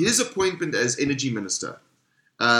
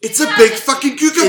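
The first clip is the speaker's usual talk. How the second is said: high, loud insistence; shouting; 11 semitones higher, 6 dB louder than usual. Real, not acted. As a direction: yell it over them. Shouted argument about zucchini.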